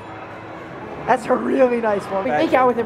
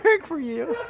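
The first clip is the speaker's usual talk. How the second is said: laughing; 11 semitones higher, 3 dB quieter, much slower than usual. Real, not acted. Laughing through voice changer.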